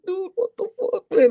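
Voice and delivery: disbelieving cry at a knockdown on TV; high excited disbelief. Retold or imitated. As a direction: pitch jumps way up in shock. Recounting shocking knockdown.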